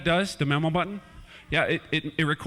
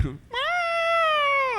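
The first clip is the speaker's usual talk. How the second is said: high squeaky mock laugh; cat laugh. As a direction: cackle like a mischievous cat.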